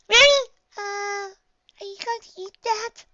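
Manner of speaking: high, hesitant filly voice; timid Pinkie. A narrator's reading. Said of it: "Pinky timidly asked".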